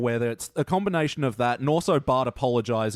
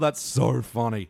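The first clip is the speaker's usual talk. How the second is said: ordinary talk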